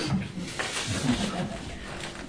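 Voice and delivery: breathy laugh held behind a hand; stifled laugh. Acted. Lionel laughs at Harry's doctor, stifled laugh. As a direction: laugh you try to hide.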